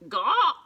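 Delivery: loud high bird squawk; sharp startled penguin call. A narrator's reading. Penguin's cry in story.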